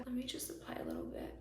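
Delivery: quick hushed whisper. Real, not acted. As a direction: murmur it under your breath. Whispered aside while applying.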